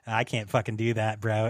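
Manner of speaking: ordinary delivery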